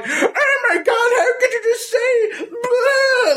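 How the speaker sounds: put-on very high, slow, quavering old-lady wail; a mock-horrified little old lady; shocked old lady. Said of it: "some little old lady heard him like".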